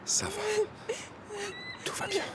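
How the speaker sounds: gentle, reassuring, often hushed; soothing. Acted. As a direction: friend hushing a panicking girl, tender and steady. Soothing a panicking friend.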